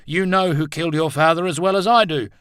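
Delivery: high, loud angry outburst; heated accusation. Acted. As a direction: hurl it as an accusation. Heated accusation before a punch.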